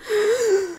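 sharp high gasp; big audible intake of breath. Real, not acted. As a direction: gasp for air after a rant. Gasps for air after a rant.

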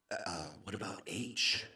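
very soft and low; timid question. Retold or imitated. Customer sheepishly asking the bartender, timid and hushed. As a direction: ask it hesitantly, almost to yourself.

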